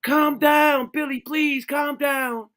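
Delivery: high, pleading mock parent voice. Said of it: "they be like".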